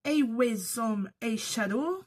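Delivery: slow, put-on English accent; mock-English reading of the name, drawn out; jokey fake English accent, said slowly. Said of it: "toujours avec mon accent anglais bidon".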